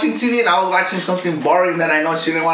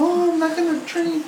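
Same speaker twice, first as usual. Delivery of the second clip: much higher, prim mock voice; mocking prissy voice; 9 semitones higher, 4 dB quieter than usual. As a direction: mimic her fake innocence.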